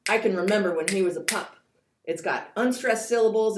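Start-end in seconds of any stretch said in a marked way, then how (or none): 0.1-1.5 fast, light and louder; brisk, bouncy recitation of the pup line; quick, frisky reading. Shown as a demonstration. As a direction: let the line skip along like a pup. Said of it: "The second line".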